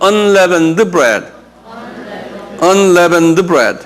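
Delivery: unleavened bread spoken very slowly, syllable by syllable; slow pronunciation demo. Shown as a demonstration. Pastor models unleavened bread for the congregation, slow and clear. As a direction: spell out unleavened bread with the mouth for learners. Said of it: "unleavened 한번 발음해봐".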